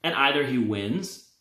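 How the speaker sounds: ordinary talk, nothing marked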